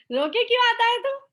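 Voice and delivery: mock-angry parent scolding a child for coming home in tears. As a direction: snap at the kid who came home crying.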